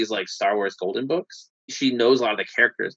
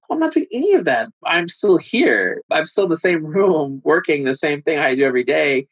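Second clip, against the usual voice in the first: higher, exasperated outburst; mock frustrated confession; exasperated admission; 5 semitones higher, 4 dB louder than usual. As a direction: blurt it like you've given up on the plan. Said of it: "And then I'm like".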